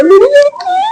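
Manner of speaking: very high, wailing cry. Retold or imitated. Frightened mother wails for her daughter, very high. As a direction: cry out for your lost girl.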